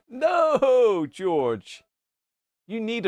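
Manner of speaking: high, drawn-out "no" falling away; exclaimed protest. Real, not acted. Streamer protesting to George in chat, high exclaimed no. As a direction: cry out in playful protest, then drop. Mock protest to a viewer's message.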